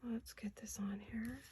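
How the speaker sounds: slow whisper to herself; hushed, murmured while working. Real, not acted.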